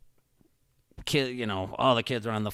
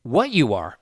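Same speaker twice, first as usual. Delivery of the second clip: loud, higher-pitched dismissal; firm retold retort; 7 semitones higher, 8 dB louder than usual. Dad shutting down a shouting cop, forceful. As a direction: cut him off forcefully.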